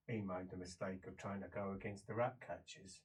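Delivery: low, soft, even report; matter-of-fact jailer. Acted. Man telling the prisoner how it happened, low and matter-of-fact. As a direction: bring it right down after the cheering. Visitor tells what happened.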